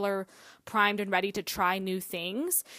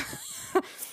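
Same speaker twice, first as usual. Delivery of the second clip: soft breathy laugh; 5 dB quieter than usual. Real, not acted. Laugh before sign-off.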